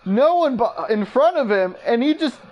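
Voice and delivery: high-pitched, incredulous, breaking off mid-phrase; stunned stuttering. Real, not acted. Commentator reacts to Kuzma missing the put back, flabbergasted. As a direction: stumble over the words in disbelief. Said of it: "I'm stuttering".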